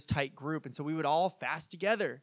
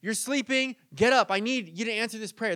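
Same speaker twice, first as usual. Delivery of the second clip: louder, higher mock nagging; annoying pestering; 3 semitones higher, 4 dB louder than usual. Pushy believer knocking to wake the Lord, mock demanding. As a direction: badger someone through a closed door. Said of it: "these annoying people knocking on the door".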